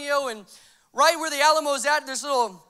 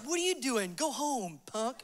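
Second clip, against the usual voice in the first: put-on dismissive older brothers; 8 dB quieter than usual. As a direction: brush him off under your breath. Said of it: "And they're like".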